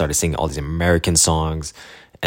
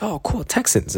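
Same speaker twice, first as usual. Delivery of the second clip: high, mildly amused; 14 semitones higher, 4 dB quieter than usual. Retold or imitated. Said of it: "And I was like".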